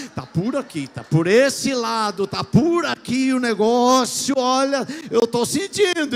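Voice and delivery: loud, slow, fervent pulpit call; stretched, shouted altar call; preaching at full force. Acted. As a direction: call out the anointing over the room. Preacher calls the anointing.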